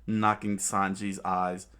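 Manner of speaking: plain reading voice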